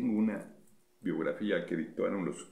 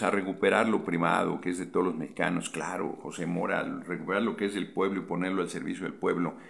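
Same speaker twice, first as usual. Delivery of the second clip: usual speaking voice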